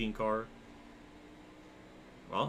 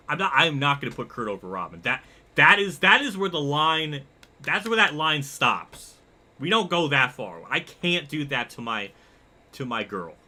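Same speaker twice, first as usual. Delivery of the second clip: loud, raised-pitch insistence; vehement refusal; 4 semitones higher, 7 dB louder than usual. Real, not acted. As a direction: draw a firm line, punching the words. Draws the line over favorite character.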